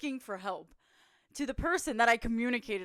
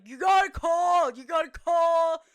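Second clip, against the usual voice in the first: high, loud, stretched-out holler; mock shouting; 8 semitones higher, 10 dB louder than usual. Teammate telling hurt player the rule, slow shout. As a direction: bellow it slowly across a field.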